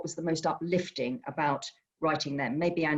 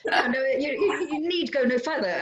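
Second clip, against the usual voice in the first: laughing; 8 semitones higher than usual. Real, not acted. Laughing agreement with speaker.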